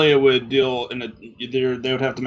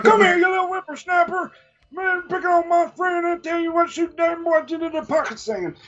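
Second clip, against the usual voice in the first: put-on high, cranky old halfling yelling; old prospector. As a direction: shout it like a grumpy old codger. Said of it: "elderly halfling swimming up to the Sahagin, like, yelling angrily".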